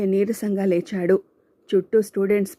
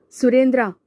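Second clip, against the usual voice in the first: higher, louder call of the name; sharp loud call; 5 semitones higher, 5 dB louder than usual. Acted. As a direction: stop his friend with one sharp word. Shanku stops Surendra. (స్నేహితుడిని ఆపుతూ గట్టిగా పిలుపు)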